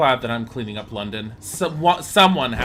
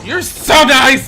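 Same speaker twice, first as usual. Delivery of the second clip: loud slow sarcastic shout; mock shout; 12 semitones higher, 16 dB louder, slower than usual. Real, not acted. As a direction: yell it out with heavy sarcasm. Sarcastic shout at game character.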